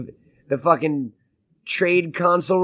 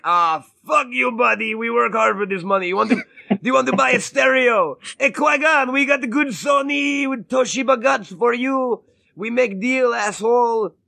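hammed-up haggling shopkeeper; mock shady merchant; 6 semitones higher than usual. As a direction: shout it like a pushy dealer. Merchant mocking Qui-Gon, shouting.

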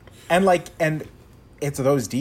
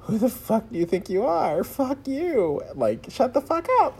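shrill rising scream, much higher, building; mock furious scream, pitch climbing higher with each line; imitated screaming; 12 semitones higher than usual. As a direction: scream it at someone in a fury. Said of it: "Julianne Moore is screaming".

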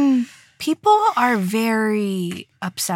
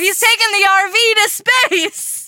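mock grand pitch voice; 12 semitones higher, 4 dB louder than usual. Voicing the writers' silly pitch.

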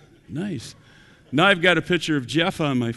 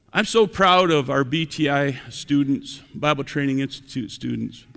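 ordinary talk

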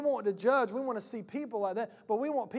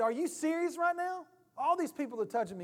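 incredulous disciple; 7 semitones higher than usual. Retold or imitated.

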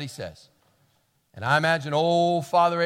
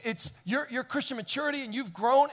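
ordinary talk, nothing marked

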